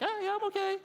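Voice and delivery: very high, strained put-on reassurance; falsetto, unconvincing "fine"; high-pitched voice. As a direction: squeak it out as if barely okay. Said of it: "when you hear the high-pitched voice".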